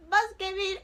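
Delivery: high, loud mock teasing; mocking friends imitation. Friends laughing at her being scammed, imitated teasing. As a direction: imitate friends making fun of you. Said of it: "They'll just laugh at you".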